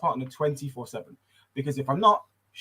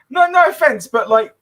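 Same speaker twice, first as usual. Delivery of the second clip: louder and higher, laughing through the question; loud laughing challenge; 8 semitones higher, 8 dB louder than usual. Retold or imitated. Uncle to crying niece about stepdad, loud laughing challenge. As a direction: demand who he is, half laughing.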